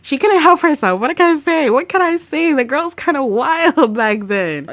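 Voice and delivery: loud, high mock defence; playful protest. Real, not acted. Streamer jokingly defending Norma, loud playful protest.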